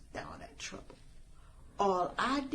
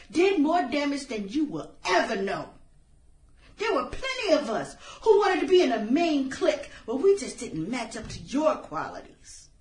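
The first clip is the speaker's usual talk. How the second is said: louder, fast, building fury; bitter confrontation; 4 semitones higher, 8 dB louder than usual. Acted. Killer confronts the popular girl, furious. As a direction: let the old resentment boil over.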